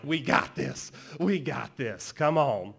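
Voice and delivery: loud, pumped-up cheer; cocky hype. Acted. [name] riding shotgun in a stunt, loud and cocky. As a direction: whoop it up like an overconfident buddy.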